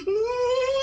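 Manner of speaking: loud, high mock wail; imitated crying wail. Comic imitating a little girl in church, mock crying wail. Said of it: "she started crying next to me".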